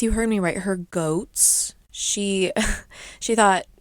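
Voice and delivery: normal talking voice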